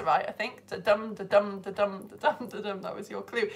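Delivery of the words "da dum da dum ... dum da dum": da-DUM, da-DUM chanted with the stress on each second beat; iambic rhythm. Shown as a demonstration. Teacher showing the class iambic pentameter, in a chanted beat. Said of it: "it was iambic pentameter".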